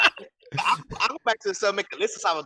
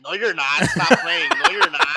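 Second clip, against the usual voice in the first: incredulous protest climbing much higher and louder; mock disbelief; 5 semitones higher, 7 dB louder, slower than usual. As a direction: play the friend who refuses to believe it, laughing.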